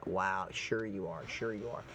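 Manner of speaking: low, quiet sarcastic sure you are; sarcastic aside. Real, not acted. Scoffing quietly at the Democrats' slogan, sarcastic. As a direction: scoff at it under the breath. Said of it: "sarcastic reply to slogan".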